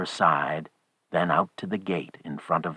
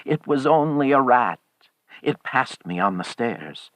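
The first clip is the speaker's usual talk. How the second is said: raised, nervous reassurance settling down; shaking voice; 7 semitones higher than usual. A narrator's reading. Old man blames a rat on stairs, shaking voice. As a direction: insist nervously, then ease off. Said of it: "said the old man in a shaking voice".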